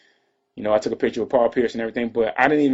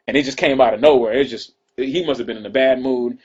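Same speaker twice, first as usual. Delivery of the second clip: normal talking voice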